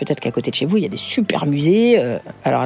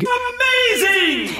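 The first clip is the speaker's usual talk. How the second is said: exaggerated high amazing; much slower than usual. Exaggerated exclamation.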